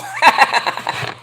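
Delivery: airy giggle; breathy laugh. Real, not acted. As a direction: laugh under your breath.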